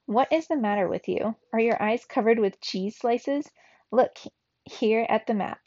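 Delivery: usual speaking voice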